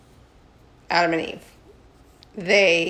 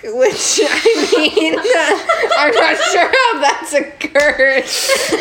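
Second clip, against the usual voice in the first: high, loud giggling speech; can barely get it out for laughing; words squeezed out between laughs; laughing through words; 9 semitones higher, 6 dB louder, much slower than usual. Real, not acted. Laughing hard mid sentence.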